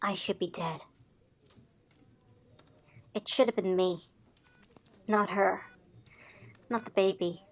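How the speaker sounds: very quiet, low and halting; hushed, hopeless confession; broken despair. Acted. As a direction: barely get the words out, crushed by guilt. Survivor voices guilt and death wish.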